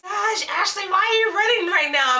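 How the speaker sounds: high, whiny mock complaint from the sister; annoyed sister. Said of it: "And she would get pissed".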